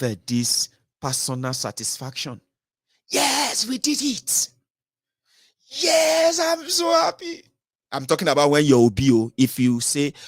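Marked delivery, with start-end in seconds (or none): 3.1-7.4 high, squealing mock delight, the last words drawn out; mock joyful cheer. A supporter mocking the winners' celebration, squealing with joy. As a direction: ham up a fan's victory joy.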